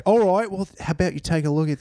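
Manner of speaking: mock British sliding into Australian; botched British accent. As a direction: attempt British, land in Australian. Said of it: "I can only do Australia now".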